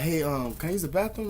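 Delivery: tentative question, lifting high at the end; courteous demo question; polite request. Shown as a demonstration. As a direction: ask it gently with a rising finish. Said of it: "then you ask me politely".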